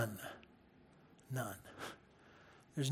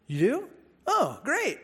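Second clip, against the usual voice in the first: sarcastic; 8 semitones higher than usual. Retold or imitated. Sarcastic reply to friend.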